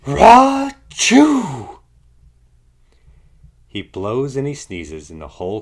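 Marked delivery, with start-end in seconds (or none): 0.1-1.8 enormous, high, loud sneeze; huge sneeze. A narrator's reading. The pepper makes the bear sneeze, a huge loud sneeze. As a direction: let the bear's sneeze explode.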